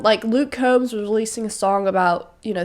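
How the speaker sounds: normal talking voice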